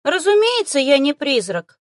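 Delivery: high, loud, scornful creature voice; condescending snort. A narrator's reading. Little beast denies being a ghost, snorting condescendingly. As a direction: dismiss it as obvious nonsense, high-voiced. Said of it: "снисходительно фыркнул зверёк".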